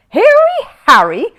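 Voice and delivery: can't believe his ears; incredulous repeat. A narrator's reading. Paddington echoing the policeman in disbelief. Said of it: "repeated Paddington, hardly able to believe".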